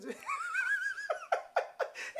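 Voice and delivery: long, very high cackling laugh; imitated priest's laugh. Commando priest after his confession, imitated high cackle. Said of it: "He's going like this".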